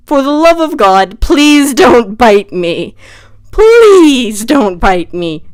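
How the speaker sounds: drawn-out, loud begging; hammed-up pleading, stretching the "please"; mock desperate plea. Retold plea to the bird.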